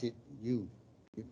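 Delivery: ordinary talk, nothing marked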